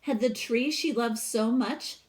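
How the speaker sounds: lively read-aloud storytelling voice; storybook reading. Acted. Teacher reading a picture book to her class, expressive read-aloud.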